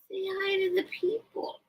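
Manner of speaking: high soft coaxing; pet talk. Real, not acted. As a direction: coax the bird to greet viewers. Talking to the bird.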